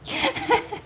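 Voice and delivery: punchline said high and loud with a laugh. Real, not acted. Telling viewers how to catch a cheater, laughing. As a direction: crack up on the punchline. Laughing at her own joke.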